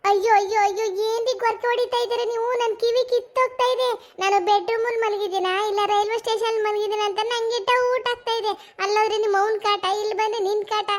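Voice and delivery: put-on fed-up young wife griping about snoring; exasperated wife. Wife character complains about snoring.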